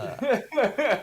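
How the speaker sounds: high, loud, emphatic "The"; loud mock correction. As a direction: bark out the correction.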